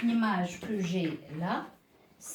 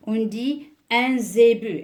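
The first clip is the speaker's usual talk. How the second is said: zébu said loud and clear, é stressed; emphatic model word; 8 dB louder than usual. Shown as a demonstration. Teacher presenting zébu to pupils, loud and clear. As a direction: announce zébu to the class, hitting the é. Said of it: "teacher models the word".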